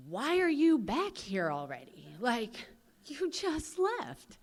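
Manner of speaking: high, drawn-out disbelief; incredulous cellmates. Retold or imitated. Cellmates seeing her back in jail six days later, imitated incredulity. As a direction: stretch it out high, mock astonishment.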